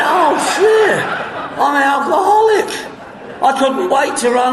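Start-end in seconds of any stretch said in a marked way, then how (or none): 0.0-2.7 delighted discovery. Retold or imitated. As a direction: burst out thrilled. Retold delighted realization.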